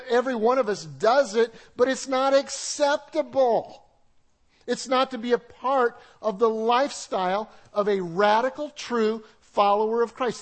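loud, emphatic preaching; full-force preaching. Acted. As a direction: press the point hard to the congregation.

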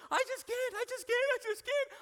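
very high, squealed mock protest; whining child. Son tells dad he can't push her, high mock whine.

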